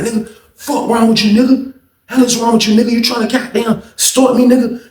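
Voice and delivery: high, heated retold accusation; angry confrontation. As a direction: act out an attacker yelling mid-fight. Acted quarrel, attacker accusing victim.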